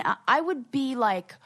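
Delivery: ordinary talk, nothing marked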